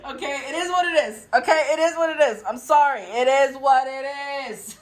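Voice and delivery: amused, high, laughing shrug-off; laughing defensive apology. Real, not acted. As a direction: laugh through it, drawing out the last phrase. Laughing after teasing her audience.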